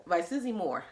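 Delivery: ordinary delivery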